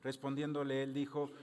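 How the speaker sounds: usual speaking voice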